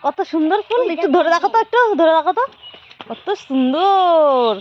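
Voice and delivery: stretched-out sing-song praise of a flower. Real, not acted. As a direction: make the flower sound magical to a little one. Showing flowers to toddler.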